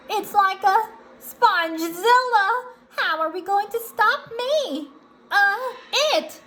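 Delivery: high, loud cartoon alarm; SpongeBob. SpongeBob wonders how to stop his giant double, alarmed. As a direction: exclaim high, then correct the slip. SpongeBob names monster, corrects himself.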